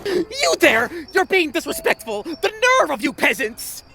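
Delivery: high, haughty scolding; indignant outburst. Acted. Crown prince scolding gossiping peasants, indignant. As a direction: rebuke them with wounded pride.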